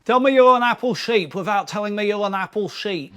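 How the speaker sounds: ordinary talk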